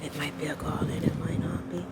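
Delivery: hushed wildlife documentary narrator; nature documentary. Pretend. Hushed wildlife-film narration of garland.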